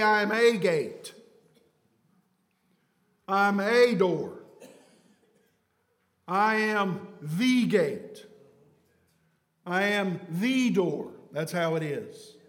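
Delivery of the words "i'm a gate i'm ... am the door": "I am THE gate" with THE drawn out; slow, weighted "I am THE door", leaning on THE; stress on THE. Acted. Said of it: "He doesn't say".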